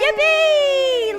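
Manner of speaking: high, loud happy cheer. Pretend. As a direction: cheer like a child. Cheering along with the story.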